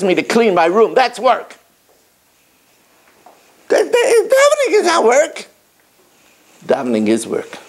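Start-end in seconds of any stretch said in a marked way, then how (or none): 3.7-5.5 mock protest. Mocking congregant's claim.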